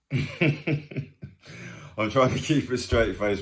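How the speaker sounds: giggling while trying to stay serious; slow, fighting back laughter; laughing. Real, not acted. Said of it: "but I can't stop laughing".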